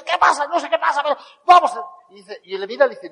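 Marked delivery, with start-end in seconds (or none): 0.0-1.7 voz muy aguda de alboroto; griterío imitado. Retold or imitated. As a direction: imita a gente gritándose confundida. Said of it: "él empieza a gritar, yo empiezo a gritar". (gritos de desconcierto actuados)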